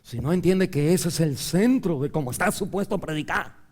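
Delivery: usual speaking voice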